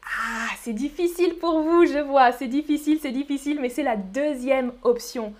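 usual speaking voice

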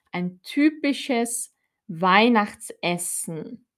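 ordinary talk, nothing marked

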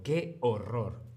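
drawn-out, slow "Qué horror". Shown as a demonstration. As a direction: stretch "Qué horror" out slowly in sympathy. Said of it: "podemos reaccionar diciendo".

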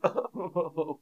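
high, wobbling ho-ho sobs; badly faked wail from a weeping Santa; mock Santa crying. Shown as a demonstration. Teacher offering learners a guess at Santa's sound, mock crying. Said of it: "Or is it Santa crying?".

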